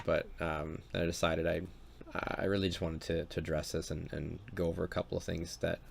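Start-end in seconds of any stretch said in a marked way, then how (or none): none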